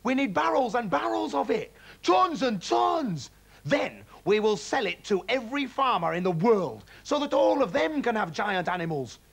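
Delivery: dream big out loud; dad carried away by riches; giddy excitement. A narrator's reading. Dad's excited get-rich plan.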